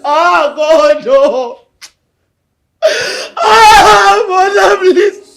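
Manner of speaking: grief-stricken wail. Acted. Son mourning his mother aloud, grief-stricken wail. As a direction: wail with grief breaking the words.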